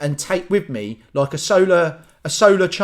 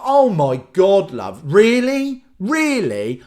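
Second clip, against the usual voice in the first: slow, higher, louder disbelief; incredulous scolding; 4 semitones higher, 4 dB louder, much slower than usual. Acted. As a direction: act appalled at a swooning stranger. Scolding moaning woman in cinema.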